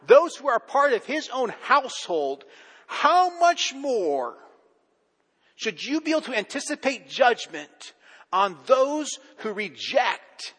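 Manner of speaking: high, loud, building sermon voice; preaching at full force. Acted. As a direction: drive the point home to the congregation.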